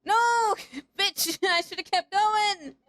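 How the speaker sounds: high, loud yelps of frustration; high-pitched gamer rage; frustrated outburst. Real, not acted. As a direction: yell at the game as it goes wrong. Frustrated yelling at the game.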